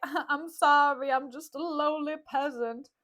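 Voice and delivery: hammed-up meek servant; slow, drawn-out mock grovelling; Grumio. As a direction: play at being the humble underling. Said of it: "Grumio was like".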